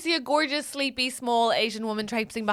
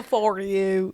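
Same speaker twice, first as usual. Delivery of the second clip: mock Forrest Gump; 5 semitones lower than usual. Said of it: "Forrest Gump".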